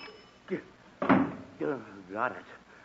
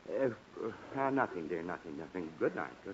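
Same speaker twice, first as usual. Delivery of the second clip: trailing off much softer and lower; sheepish retreat; 10 semitones lower, 10 dB quieter than usual. Acted. Husband backs down to his wife, quieter and lower each time. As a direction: back down, letting each nothing fade.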